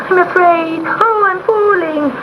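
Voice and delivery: high, slow fearful cry; a tiny kitten terrified of falling; frightened kitten. A narrator's reading. Kitten crying out in fear to the sea witch. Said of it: "sobbed Gobolino".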